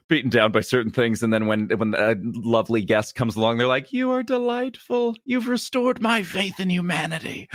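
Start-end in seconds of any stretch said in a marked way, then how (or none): 3.9-7.5 high, slow, gushing thanks; gushing cast member. Retold or imitated. Worn-down cast member gushing thanks to a lovely guest, high. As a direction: overflow with grateful relief.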